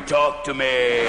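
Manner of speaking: low, slow beckoning toilet; deep slow voice of the toilet; talking toilet. Retold or imitated. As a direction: call him over low and slow. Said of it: "the old toilet in the corner going".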